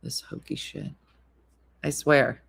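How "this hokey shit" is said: whispered fast complaint; muttered aside. Retold or imitated. Missy muttering to Jeremy about Dina, whispered and fast. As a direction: gossip under breath in a hurry. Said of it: "She's like".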